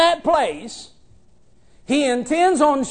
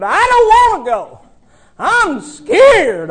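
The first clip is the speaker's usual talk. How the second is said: higher, louder mock fear; mock scared whine; 8 semitones higher, 5 dB louder than usual. Preacher begging David not to send him to the giant. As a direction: whine like a frightened child.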